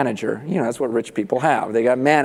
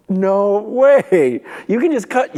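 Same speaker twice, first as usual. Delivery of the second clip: loud, high-pitched incredulity; hammed-up astonishment; amazed disbelief; 5 semitones higher, 5 dB louder, slower than usual. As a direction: act out a stunned debtor, pitched way up. Debtor reacts to the offer.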